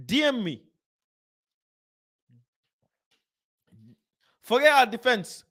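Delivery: high-pitched, urgent call; excited calling out. Real, not acted. Streamer telling a remembered viewer to message him, excited calling out.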